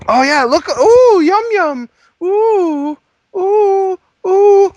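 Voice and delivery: very high, loud cooing; excited dog talk. Pretend. Offering the dog a treat, very high excited cooing. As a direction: coo over the dog with the treat.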